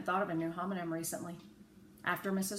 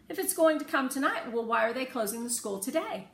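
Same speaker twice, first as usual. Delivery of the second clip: Rose questioning; 7 semitones higher, 6 dB louder than usual. A narrator's reading. Rose argues with father.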